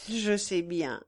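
usual speaking voice

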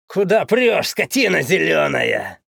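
angry yell at a queue jumper. Acted. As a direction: shout it out, rude and loud. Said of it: "стали орать".

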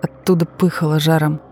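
usual speaking voice

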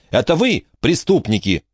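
high start, dropping accusation; indignant. A narrator's reading. Millard accusing the captors, indignant. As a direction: turn the charge back indignantly. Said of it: "возмутился Миллард".

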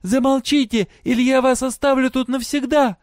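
high, loud commanding yell; shout. A narrator's reading. Girl silencing noisy prisoners, shouting. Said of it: "и закричала".

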